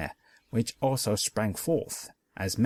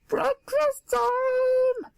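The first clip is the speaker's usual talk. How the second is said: high, drawn-out call from downstairs; 3 dB louder, much slower than usual. Acted. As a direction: sing it up the stairs.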